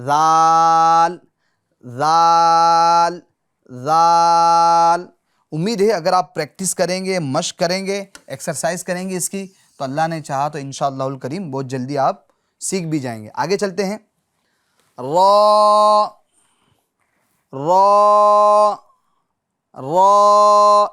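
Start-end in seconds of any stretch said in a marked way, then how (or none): 0.1-5.1 ذال held long and loud, tongue tip at the teeth; the proper ذال, stretched slowly; correct zaal drawn out. Shown as a demonstration. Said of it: "کس طریقے سے ادا کرنا ہے". 15.0-20.9 را said loud and full-mouthed, thick; a thick heavy را; heavy full raa. Shown as a demonstration. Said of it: "اس کو پور کرنا، موٹا پڑھنا ہے".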